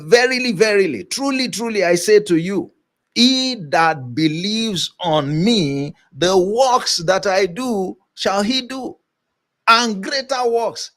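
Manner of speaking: high, ringing, emphatic proclamation; emphatic Jesus quote. Retold or imitated. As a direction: lift each verily like a solemn promise. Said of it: "He said".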